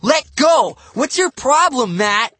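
put-on loud, high furious kid; angry shout. A narrator's reading. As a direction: yell at him to let go.

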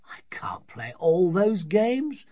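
high, loud, drawn-out protest; desperate father. A narrator's reading. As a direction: plead as an overwhelmed dad. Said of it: "said her father desperately".

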